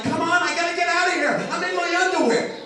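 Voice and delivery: high, loud panicked pleading. Retold or imitated. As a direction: panic like in a nightmare. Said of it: "you're like".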